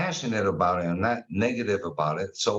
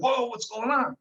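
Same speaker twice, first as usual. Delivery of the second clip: alarmed; 13 semitones higher than usual. Retold or imitated. Seeing daughter on couch, alarmed.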